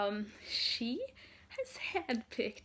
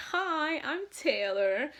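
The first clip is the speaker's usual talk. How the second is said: higher, louder, drawn-out cheery greeting; 4 semitones higher, 6 dB louder, slower than usual. Retold or imitated. Pop star introducing herself to fans, imitated high and slow. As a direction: imitate a star's slow, lifted hello. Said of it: "she was like".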